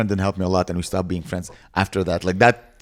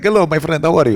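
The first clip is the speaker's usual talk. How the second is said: high, louder Middle Eastern-ish greeting; friendly vendor accent, pitched up; generic foreign accent; 7 semitones higher, 5 dB louder than usual. Shown as a demonstration. Comic shows how his Israeli and Palestinian sound alike in generic accent. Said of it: "they both talk like this".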